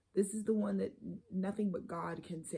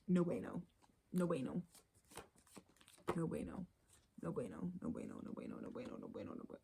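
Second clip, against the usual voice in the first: fading repetition; 2 semitones lower, 8 dB quieter than usual. Real, not acted. Repeated phrase fading away.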